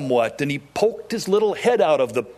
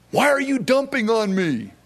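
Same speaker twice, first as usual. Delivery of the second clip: higher, indignant complaint; mock whine; 7 semitones higher than usual. Preacher mimicking a complainer to the flock, higher whine. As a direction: voice the wronged whiner.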